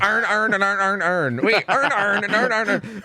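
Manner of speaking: high mock Baltimore "earn, earn", every word identical; Baltimore accent. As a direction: imitate Baltimore kids tripping over "earn". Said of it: "kids from Baltimore that tried to say Aaron earned".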